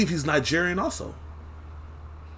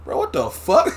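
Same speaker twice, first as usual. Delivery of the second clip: baffled; 4 semitones higher, 3 dB louder than usual. Retold or imitated. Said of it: "you're like".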